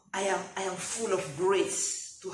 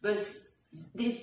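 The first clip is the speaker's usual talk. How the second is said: normal talking voice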